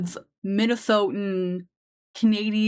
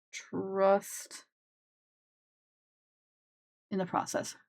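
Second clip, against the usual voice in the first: soft aside; 9 dB quieter, slower than usual. Real, not acted. Mock motto while drawing.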